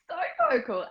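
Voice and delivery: high laughing reply. Real, not acted. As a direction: laugh the words out. Laughing at teasing.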